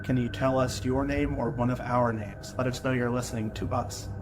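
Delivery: hushed, careful questions to a spirit; talking to a ghost. Real, not acted. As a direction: quietly invite the spirit to answer. Investigator addresses spirit.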